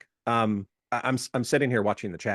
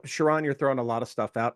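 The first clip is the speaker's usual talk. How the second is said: normal talking voice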